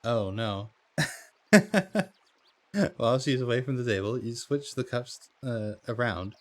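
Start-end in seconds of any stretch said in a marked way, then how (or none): 1.5-2.1 short real laugh; loud laugh. Real, not acted. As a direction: burst of loud, high laughter. Player reacts to risky choice.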